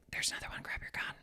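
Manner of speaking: tense, hushed whisper to grab the gun; urgent whisper. Retold or imitated. Partner whispering to the hunter that another buck is coming, urgent whisper. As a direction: whisper fast so the deer won't hear.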